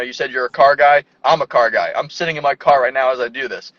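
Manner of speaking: normal talking voice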